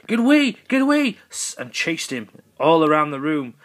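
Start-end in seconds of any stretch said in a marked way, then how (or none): 0.1-1.2 much higher, louder frightened squeal; panicked squeal. Acted. President fleeing the snake, high panicked squeal. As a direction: shriek in cartoon panic.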